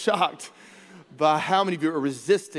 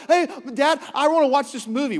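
put-on higher, louder pleading kid voice; kid begging dad; 7 semitones higher, 6 dB louder than usual. Kid asking dad about a movie, eager pleading. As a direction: play the eager child asking permission.

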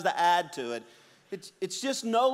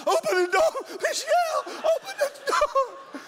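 much higher, louder strained cry, door to door; strained call for help; 4 dB louder than usual. Retold or imitated. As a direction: yell for Michelle while overloaded. Straining under forty grocery bags.

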